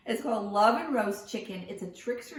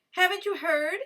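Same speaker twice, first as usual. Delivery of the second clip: high, louder mock panic; alarmed cry; 9 semitones higher, 7 dB louder than usual. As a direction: sell the panic to trick the fox. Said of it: "cried Cuy".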